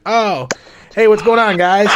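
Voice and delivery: high, loud mock-cheerful hello; fake friendly greeting. Pharmacist greeting crackheads, high and loud mock cheer. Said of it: "he'd be like".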